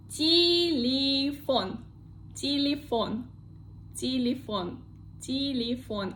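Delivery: телефон as ti-li-FON, each unstressed vowel an "ee"; model pronunciation. Shown as a demonstration. Teacher drilling the unstressed-vowel rule, телефон as ti-li-FON. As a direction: say телефон with "ee" twice before stressed FON. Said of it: "we pronounce it like E".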